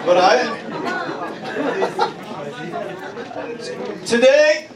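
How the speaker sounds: high, loud fiery declaration; rally the whole church with each line; preaching at full force. Acted. Pastor declaring the church comes out from the cemetery, full-force preaching.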